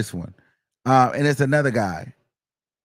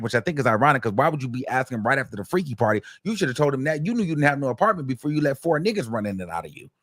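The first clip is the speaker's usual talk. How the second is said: ordinary talk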